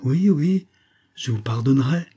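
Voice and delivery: high, hurried-sounding yes, then easing; alarmed assurance. A narrator's reading. Olivia placates the impetuous woman, alarmed assurance. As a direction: agree anxiously, starting high and loud. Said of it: "alarmée par l'impétuosité des manières".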